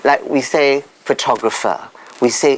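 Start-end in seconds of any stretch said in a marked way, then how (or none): 0.8-1.8 "photographer" stressed on the second syllable; shifting word stress. Shown as a demonstration. Speaker shows stress shift, "photographer" stressed on TOG. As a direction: say "photographer" as pho-TOG-rapher. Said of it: "the stress could shift".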